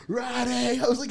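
high-pitched, hammed-up call for help; mock panicked cry. Drunk fan calling his friend for rescue, mock panicked cry. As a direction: wail the name in comic desperation. Retold plea to friend by phone.

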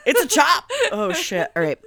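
ordinary talk, nothing marked

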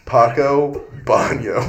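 Paco Baño stretched slow and proud; deadpan slow Paco Baño; slow drawn-out answer. Retold or imitated. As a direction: drag out Paco Baño like a punchline. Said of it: "and I'd go".

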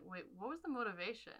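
quick, puzzled mock question; fast confused quote. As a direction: rattle it off, confused. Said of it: "I'm like".

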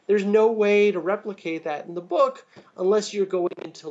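ordinary talk

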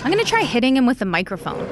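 normal talking voice

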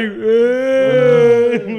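dazed grunt. Retold or imitated. Kid self sniffing fumes.

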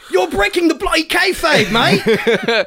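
high-pitched mock-outraged British; British accent. British self scolding partner for breaking character, high British accent. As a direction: protest in a hammed-up British accent. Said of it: "those versions of us are actually British".

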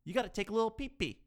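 high, fast cutesy voice; baby talk. Acted. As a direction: say it like to a toddler.

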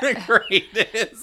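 laughing. Real, not acted. Laughing slow.

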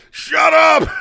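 a mortified mom's high shriek. Retold or imitated. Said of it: "her mom's like".

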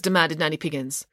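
usual speaking voice

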